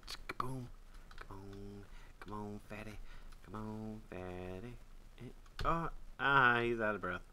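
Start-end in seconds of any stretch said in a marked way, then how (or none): none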